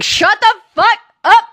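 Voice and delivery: high, emphatic outburst; mock exasperated yell. Acts silencing a bad singer.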